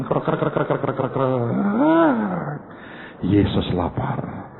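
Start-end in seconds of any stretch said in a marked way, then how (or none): 0.0-0.7 quick rasping belly noises; stomach growl. Retold or imitated. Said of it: "suara aneh bunyi dari perut". 0.8-2.6 very slow, long creaking belly groan; drawn-out stomach growl. Retold or imitated. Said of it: "suara aneh bunyi dari perut".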